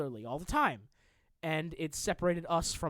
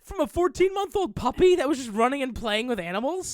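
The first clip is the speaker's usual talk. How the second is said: high, louder disbelieving question; incredulous outrage; 7 semitones higher, 6 dB louder than usual. Real, not acted. Reacting to a politician's excuse, incredulous outrage. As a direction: pitch it up in appalled disbelief.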